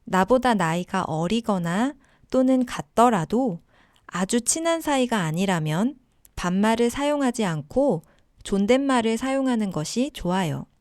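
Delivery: ordinary talk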